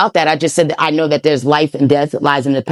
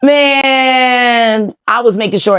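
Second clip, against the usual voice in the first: exasperated protest; 7 semitones higher, much slower than usual. Real, not acted. Protests against accusation of doubt.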